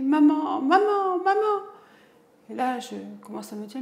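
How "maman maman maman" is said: high, loud repeated call; retold crying out. Daughter calling her mother in a panic, loud. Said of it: "ma fille me crie".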